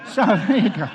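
real amused laugh breaking in. Real, not acted. Pastor laughs at the hamster's name, real laughter.

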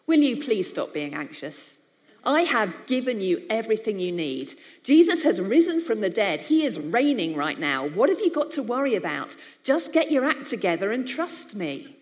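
loud, exasperated scolding; grumpy scolding God. Pretend. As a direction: tell them off like a fed-up parent. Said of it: "we hear it in this tone of voice".

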